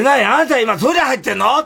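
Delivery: high, loud mock demand through the door; mock boss voice. The lady boss calling to the narrator in the toilet, high and mock-sharp.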